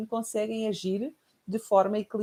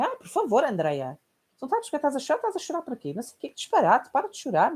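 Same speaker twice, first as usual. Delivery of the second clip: higher, louder mock scolding; scolding mother; 7 semitones higher, 4 dB louder than usual. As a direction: brush the tears off impatiently. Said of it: "Minha mãe". (mãe a ralhar, mais aguda e alta)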